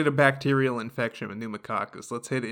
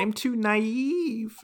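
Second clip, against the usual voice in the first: put-on slow, higher whine of a timid kid; whiny kid; 10 semitones higher, much slower than usual. Friend voicing the timid T cell, slow high whine. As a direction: drag it out like a scared kid.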